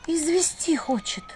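high, slow, faint dread; shocked murmur. A narrator's reading. Vasilisa learning he wants her dead, stunned murmur. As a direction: say it slowly, as if legs give way. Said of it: "прошептала Василиса, едва не осев".

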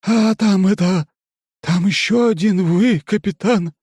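high, slow, bewildered creature voice; startled alien voice. A narrator's reading. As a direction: blurt it out, dazed and confused. Startled alien reports a double.

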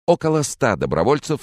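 lightly voiced off-screen reporter; news voice-over. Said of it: "вступил голос за кадром".

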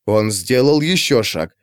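plain reading voice

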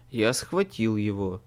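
ordinary delivery

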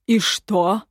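sneering overlord; scornful smirk. A narrator's reading. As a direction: toss it out with a smirk. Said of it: "усмехнулся повелитель".

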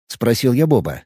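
ordinary delivery, nothing marked

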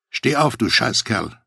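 plain reading voice

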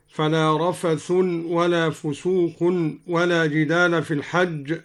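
"رفث" and "فسوق" end in -un; nominative with tanween. Shown as a demonstration. Teacher quotes al-Duri's reading, -un on "رفث" and "فسوق". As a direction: sound an un ending on "رفث" and "فسوق". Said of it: "قرأ الدوري بالرفع والتنوين".

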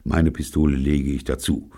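ordinary delivery, nothing marked

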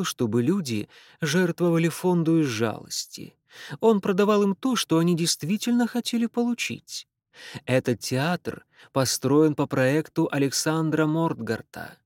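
brisk Irish tour-guide voice; Irish accent. Acted. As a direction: sell it in an Irish lilt. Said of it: "сильный ирландский акцент".

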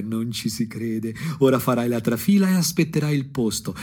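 ordinary delivery

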